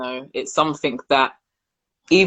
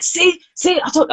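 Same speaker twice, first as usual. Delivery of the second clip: put-on high, teasing kid's told-you-so; gloating son. Imitating her son gloating to mum about the route, high and teasing. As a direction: mimic a child crowing he was right.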